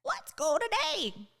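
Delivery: high, squawking parrot chirp; squawky bird voice. Acted. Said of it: "in a squawky voice".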